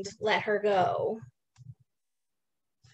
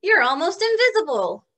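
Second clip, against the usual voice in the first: pigeon voice; 6 semitones higher, 6 dB louder than usual. Acted.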